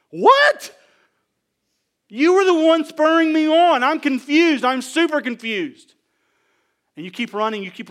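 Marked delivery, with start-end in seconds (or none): 0.1-0.8 high, loud yelp of disbelief; shocked outburst. Acted. Acts shock at friend's lost faith. 2.1-5.9 loud, high-pitched bewilderment, easing at the end; baffled protest. Acted. Acts baffled reply to quitting friend.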